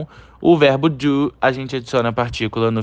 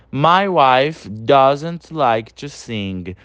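slow sentence with "doesn't" said whole; slow model reading; much slower than usual. Shown as a demonstration. Teacher reading the contracted example to students, slowly. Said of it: "Então".

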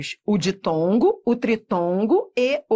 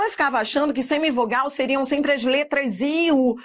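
ordinary talk, nothing marked

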